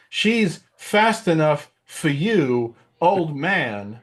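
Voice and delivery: high, very slow, stretched Walken line; Christopher Walken impression. Walken as Han teasing Obi-Wan, very slow. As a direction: lean into every word like Walken. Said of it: "his Christopher Walken was pretty hilarious".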